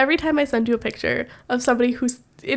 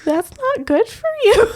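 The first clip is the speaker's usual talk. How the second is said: laughing quote; 11 semitones higher than usual. Retold or imitated. Quoting husband while laughing.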